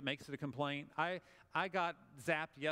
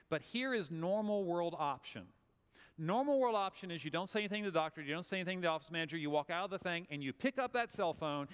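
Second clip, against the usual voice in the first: ordinary delivery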